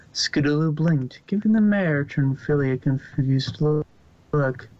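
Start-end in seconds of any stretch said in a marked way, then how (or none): none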